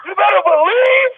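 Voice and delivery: high, shouted sermon climax; preaching at full force. Acted. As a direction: lift the room with each "you better". Pastor at peak preaching.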